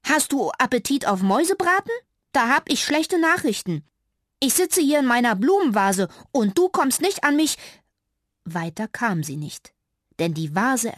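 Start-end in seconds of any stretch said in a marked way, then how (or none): 0.0-7.6 high, loud cheeky mouse teasing; a bold little mouse mocking the cat. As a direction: taunt the cat to make it angry. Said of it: "so frech sie konnte".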